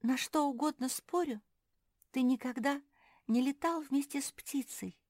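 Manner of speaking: high-pitched little bird voice; lightly voiced chirpy bird; chirping bird. A narrator's reading. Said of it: "во всю мощь зачирикала Ляля".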